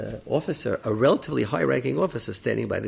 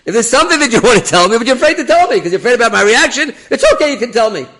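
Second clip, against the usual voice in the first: very high, loud, pushy rush of words; insistent repeat; 12 semitones higher, 13 dB louder, faster than usual. Retold or imitated. Rabbi repeats himself to the officer, insistent high shout. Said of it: "I'll repeat myself".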